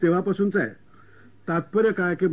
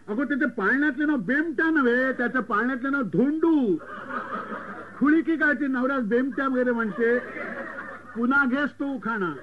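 higher, mock-cross correction; snapping uncle; 6 semitones higher than usual. Uncle corrects the bride's name mistake, higher and mock-cross. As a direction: snap at the bride to redo it. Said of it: "काका पुन्हा खेकटला".

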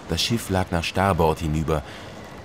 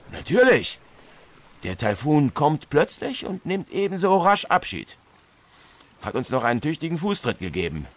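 hearty captain; 7 semitones higher than usual. Acted. Captain explains the typhoon.

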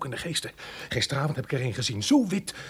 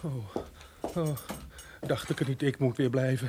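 soft grumble; 9 dB quieter than usual. Acted. Servant grumbles softly.

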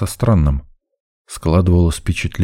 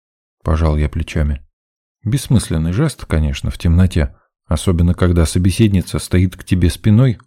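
ordinary delivery

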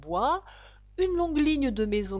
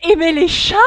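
high, very loud outraged shriek; piercing angry cry; 7 semitones higher, 15 dB louder than usual. A narrator's reading. The mouse answers Alice's talk of cats, piercing angry cry. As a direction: shout it in a piercing, indignant voice. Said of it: "cria la souris d'une voix perçante et colère".

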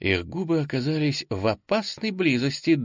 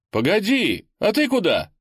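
much higher, louder call; calling after her; 10 semitones higher, 4 dB louder than usual. A narrator's reading. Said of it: "окликнул он". (окликает вслед)

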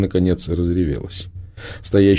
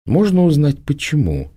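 a coolly polite, slightly raised question; polite inquiry; 9 semitones higher than usual. A narrator's reading. Prima politely asks the girl why, coolly. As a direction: ask with icy courtesy. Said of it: "вежливо осведомилась Прима".

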